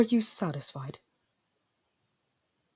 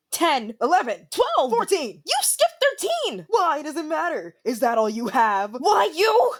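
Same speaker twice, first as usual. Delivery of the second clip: bickering maid; 11 semitones higher, 4 dB louder, slower than usual. Acted. Maids bidding and bickering.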